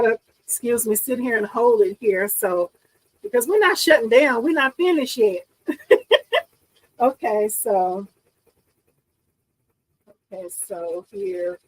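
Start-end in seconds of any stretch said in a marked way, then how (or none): 5.7-6.4 short high giggle; bright giggle. Real, not acted. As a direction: laugh at herself. Laugh after defiance.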